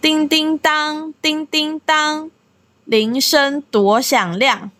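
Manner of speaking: each syllable of the Chinese lyric spoken slowly and clearly; careful teacher's lyric recitation; slow lyric reading. Shown as a demonstration. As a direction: read the chorus line slowly for learners. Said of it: "やっと次はサビに入ります".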